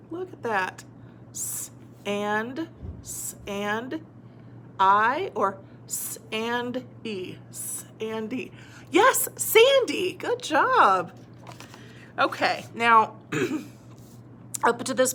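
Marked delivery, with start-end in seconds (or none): 1.3-8.5 soft, segmented S, AND, then the ending; sounding out. Shown as a demonstration. Teacher testing endings on a word, sounded out softly. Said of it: "see which one sounds right". 8.9-11.1 high, drawn-out delighted cheer; excited praise. Shown as a demonstration. Teacher praising a learner who found Sandy, excited. Celebrates working out the word.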